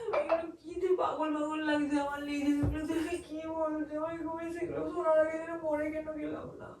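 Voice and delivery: despairing plea fading to quiet weeping; broken, helpless lament; tearful pleading. Real, not acted. Wife begs against divorce through tears.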